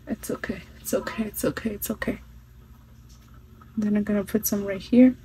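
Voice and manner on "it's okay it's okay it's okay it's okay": self-soothing murmur. Real, not acted. Reassuring herself after a mishap.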